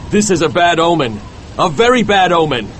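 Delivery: nervous. A narrator's reading. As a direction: warn with a touch of nerves. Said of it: "said Starbuck nervously".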